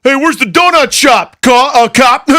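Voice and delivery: loud, high jeering call at a cop; mocking cop taunt. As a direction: heckle him like a wiseguy razzing a cop. Mocking a cop to his face.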